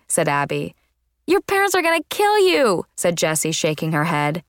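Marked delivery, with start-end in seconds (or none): 1.2-2.8 high-pitched, scandalised exclamation; aghast warning. A narrator's reading. Friend reacting to a nose ring, aghast warning. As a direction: warn her in high-pitched shock.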